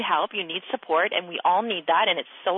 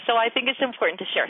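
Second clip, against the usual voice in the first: normal talking voice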